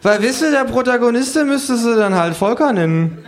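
hohe, laute Figurenstimme; hoch den Witz erklären; Känguru. Acted. (hohe Känguru-Stimme, erklärend)

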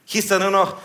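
plain reading voice

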